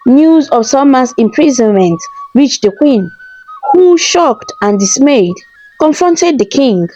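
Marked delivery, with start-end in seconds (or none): none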